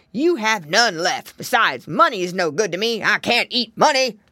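loud, growling big bad wolf; growly wolf. A narrator's reading. Wolf scorns the pig mother's money, growling. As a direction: growl your scorn at the offer. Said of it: "more growlier than before".

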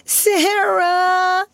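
very high, drawn-out wail; wailing child. A narrator's reading. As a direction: cry out for help like a small child. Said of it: "wailed Lottie".

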